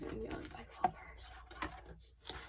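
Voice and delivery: soft breathy whisper of adoration; whispered gush. Real, not acted. Fan whispering over her favorite member, softly.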